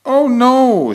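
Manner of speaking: high, loud eager protest; excited. Acted. Kangaroo mother eagerly reassuring the cat, high and loud. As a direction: burst out with excitement. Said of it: "she replied excitedly".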